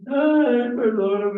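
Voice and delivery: high, loud mock wail for forgiveness; mock crying plea. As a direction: ham up a tearful plea. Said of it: "llorar ahí a moco tendido". (súplica llorosa exagerada)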